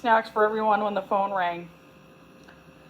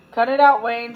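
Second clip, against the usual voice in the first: louder, fed-up retort; mild irritated shout at a joker; annoyed; 7 dB louder than usual. A narrator's reading. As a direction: tell the prankster to quit, loudly. Annoyed at a prank call.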